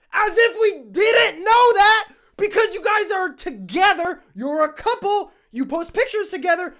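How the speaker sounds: high, loud, shouted tirade; worked-up, high-pitched yelling; exasperated rant. Real, not acted. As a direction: shout it out, piling up complaints in exasperation. Worked-up rant at oversharing couples.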